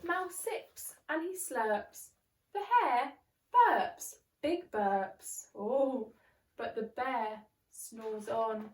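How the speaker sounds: put-on lively read-aloud for children; bouncy story voice for little ones; storybook narration. A narrator's reading. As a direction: read it like a bedtime picture book. Narrating a picture book.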